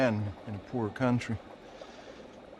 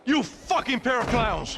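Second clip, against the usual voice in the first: slow, higher angry berating; furious rebuke; 10 semitones higher, slower than usual. Acted. Boss scolding his two bodyguards for failing, angry. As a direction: spit each word out at them.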